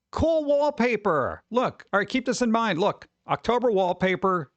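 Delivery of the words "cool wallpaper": slow, high, admiring; impressed. Real, not acted. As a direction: savor the word cool. Admiring the wallpaper.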